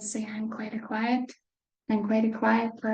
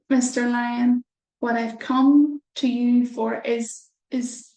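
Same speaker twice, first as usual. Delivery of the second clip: mouse speaking up; 7 dB louder than usual. Acted. Mouse addresses the lion.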